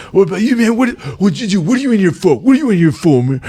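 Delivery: high, loud, menacing demand; aggressive inmate. Retold or imitated. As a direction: corner him like a roid-raging con. Con confronting the speaker.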